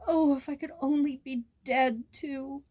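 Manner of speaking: high tearful wish; despairing moan. A narrator's reading. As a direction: plead it through sobs. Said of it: "he moaned".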